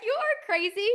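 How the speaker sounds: high, loud amused outburst; laughing disbelief. Retold or imitated. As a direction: exclaim in delighted disbelief. Mom reacting to kid's joke.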